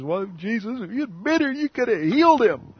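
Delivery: high, pleading voice of a grieving sister; upset Martha. Retold or imitated. Grieving Martha reproaching Jesus, high and pleading. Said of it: "Martha's all upset".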